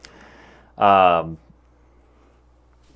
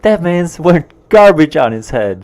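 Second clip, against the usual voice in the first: higher, louder mock Australian accent; stupid Australian accent; 7 semitones higher, 3 dB louder, faster than usual. Co-host quoting Justice looking over at people, stupid Australian accent. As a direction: put on a silly Australian accent. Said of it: "In this stupid Australian accent".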